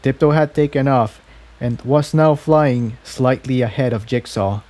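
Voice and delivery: usual speaking voice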